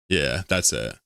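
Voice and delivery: ordinary delivery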